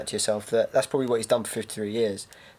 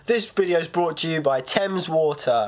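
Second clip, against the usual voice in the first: mock ad read; 4 semitones higher, slower than usual.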